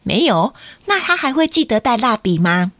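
ordinary delivery

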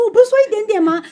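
high, indignant mock complaint; exasperated high protest. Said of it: "然后我就想说". (拉高音调、不可置信地抱怨)

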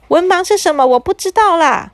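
put-on high innocent kid voice; puzzled child. A narrator's reading. Boy asks the wizard what illiterate means, high childish voice. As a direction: ask it like a clueless little boy.

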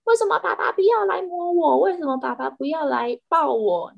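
high, pleading kitten voice, whining for daddy; whiny cat. Retold or imitated. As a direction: beg like a clingy spoiled cat. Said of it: "它就说". (撒娇的猫咪声音)

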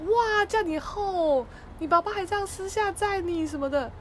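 very slow, high mock amazement; hammed-up mom. Mom faking amazement at her child, hammed-up. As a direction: stretch it out like bad acting. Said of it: "演得很烂".

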